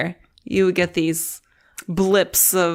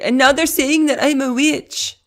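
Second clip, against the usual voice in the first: high, loud mock Russian accent; bad Russian accent; 8 semitones higher, 4 dB louder than usual. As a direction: put on a terrible Russian accent. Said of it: "That's a terrible Russian accent".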